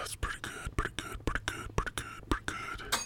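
voiceless repeated whisper; whispered aside. Real, not acted. Host to listeners after plugging songs, whispered. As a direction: whisper it softly over and over.

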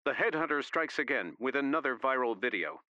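a hint of a TV anchor; lightly voiced newscaster; news reporter voice. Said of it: "a man saying".

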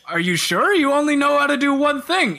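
high-pitched, hammed-up doubt; mock incredulous. Interviewer doubts the one-spell wizard, mock incredulous. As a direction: question it in put-on disbelief.

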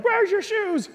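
high-pitched scolding mom; squeaky-high mom impression; imitated mom. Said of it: "the mom's like".

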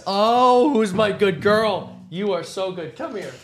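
high, slow cooing to a puppy. Real, not acted. Cooing at the dog Atlas.

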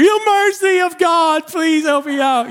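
high, slow mock-pious wail; dramatic church kid. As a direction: plead like a melodramatic child crying out for mercy. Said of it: "Like a kid that grew up in church".

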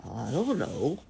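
drowsy father bear's rumble; sleepy father bear. A narrator's reading. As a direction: answer like a papa bear half asleep. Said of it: "said father and rumbled back to sleep".

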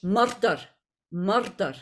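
Scottish accent with a rolled R in "murder"; Scottish "murder", R sounded. Shown as a demonstration. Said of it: "if I was to say in Scottish".

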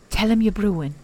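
plain reading voice

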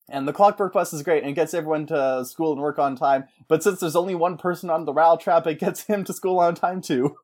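ordinary talk

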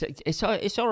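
ordinary delivery

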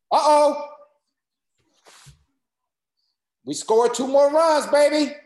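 loud, high cheering at a score; excited cheer. Real, not acted. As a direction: whoop it up as your team scores. Cheering at a live game score.

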